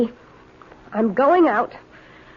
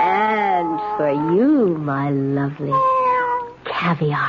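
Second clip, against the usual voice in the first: lower, slow cooing to a pet; slow, lower doting offer; 7 semitones lower, slower than usual. Acted. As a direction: tempt the cat, slowly. Girl cooing to the cat.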